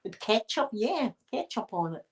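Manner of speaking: imitated American accent; trying on an American accent; put-on American accent. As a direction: order in your best mock American. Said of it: "American accent".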